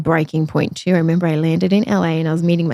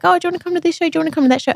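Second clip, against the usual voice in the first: friends inviting; 10 semitones higher, much faster than usual. Retold or imitated. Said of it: "My friends would all be like".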